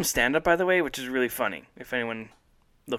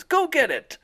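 bully taunting; 14 semitones higher, 4 dB louder than usual. Retold or imitated. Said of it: "he goes".

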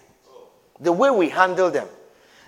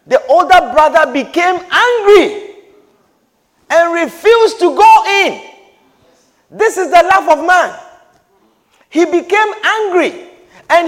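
preaching; 9 semitones higher, 8 dB louder than usual. Real, not acted. Preaching at raised pitch.

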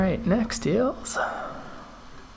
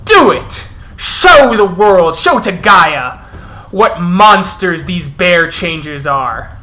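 furious wolf elder; 6 dB louder than usual. Acted. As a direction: thunder at the crowd as the wolf chief. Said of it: "as the Elder goes".

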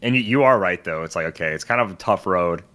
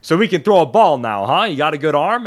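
higher, louder mock Sopranos tough guy; Sopranos-type guy; 7 semitones higher, 6 dB louder than usual. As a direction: ham up the neighbour from The Sopranos. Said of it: "straight out of The Sopranos".

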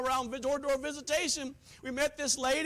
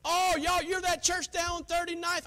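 raised, louder retold reaction; mock an upset churchgoer-hater lightly; upset woman, imitated; 7 semitones higher, 5 dB louder than usual. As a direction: imitate her indignant recognition. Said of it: "And she got upset".

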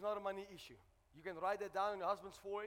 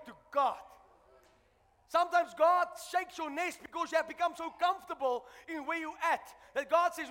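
loud, rising sermon shout; full-force preaching; 6 semitones higher, 11 dB louder than usual. Acted. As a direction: preach it out, roaring to the church.

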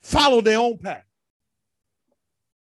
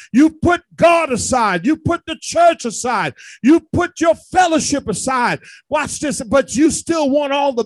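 full-force preaching; 4 semitones higher than usual. Acted. Pastor urging the congregation, preaching hard. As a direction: preach it, hammering each line.

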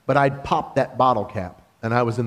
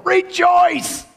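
high, ringing call to rejoice; a preacher crying out a joyful command; exultant cry; 4 dB louder than usual. Acted. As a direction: lift the word up like a shout of praise. Paul's call from jail.

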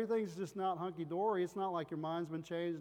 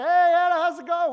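loud, high greeting; sister yelling hello; 12 semitones higher, 17 dB louder than usual. Retold or imitated. Sister greeting his wife Anna, loud high holler. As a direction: holler hi across the room. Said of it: "My sister comes in".

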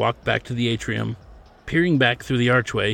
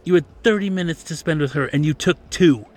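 higher, louder reproachful lecture; scolding; 5 semitones higher, 4 dB louder than usual. A narrator's reading. Manager scolding his employer over a guest, scolding. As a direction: tear into him for the insult.

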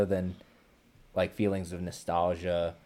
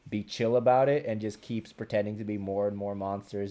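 ordinary delivery

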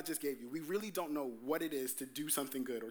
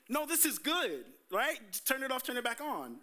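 higher, upbeat and sure of himself; naive confidence; 6 semitones higher than usual. Retold or imitated. Young man convincing himself the car trick will work, cocky. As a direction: sell yourself on a bad idea. His confident inner thought.

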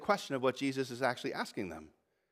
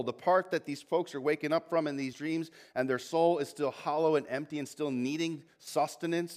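usual speaking voice

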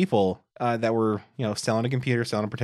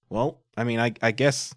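normal talking voice

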